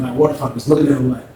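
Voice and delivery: ordinary delivery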